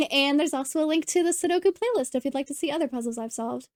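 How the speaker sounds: usual speaking voice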